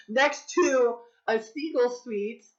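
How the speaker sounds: laughing through the words. Real, not acted. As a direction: laugh while listing it. Laughs at her workplace.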